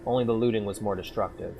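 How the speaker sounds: steady, low-key audiobook reading; calm first-person story reading; story narration. A narrator's reading. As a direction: read the tale evenly to the listener. Reads fantasy story aloud.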